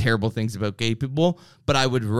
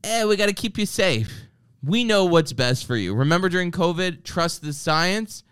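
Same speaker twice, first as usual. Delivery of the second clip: mocking authority voice; 6 semitones higher than usual. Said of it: "always under the guise of".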